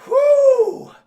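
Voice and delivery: loud, high victory whoop; mock cheering. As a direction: whoop it up in triumph. Retold cheer after escaping the blast.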